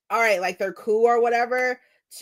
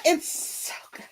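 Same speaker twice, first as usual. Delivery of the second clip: breathy whisper of awe; whispered gush; 7 semitones higher, 5 dB quieter than usual. Real, not acted. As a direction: drop to an awed whisper. Gushing about the book.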